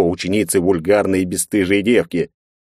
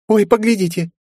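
put-on giggling girl. A narrator's reading. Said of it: "хихикнула ещё одна девочка".

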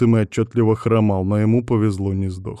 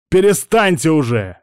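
loud, high-pitched bark; barked order; 8 semitones higher, 8 dB louder than usual. A narrator's reading. Алан breaking up the bickering, barked order. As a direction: cut in sharply with a raised voice. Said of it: "рявкнул Алан".

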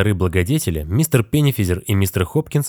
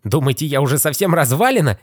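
put-on offended old man; 8 semitones higher than usual. A narrator's reading. Old man indignant at being left.

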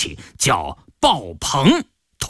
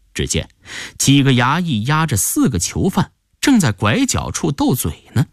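plain reading voice

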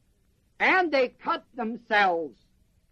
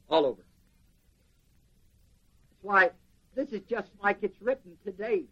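usual speaking voice

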